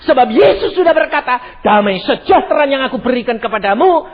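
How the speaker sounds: loud, high preaching call; fiery sermon voice. Acted. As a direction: preach it out to the hall. Preaching at full force.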